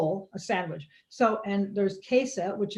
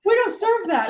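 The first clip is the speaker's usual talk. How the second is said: mock baker; 11 semitones higher, 6 dB louder than usual.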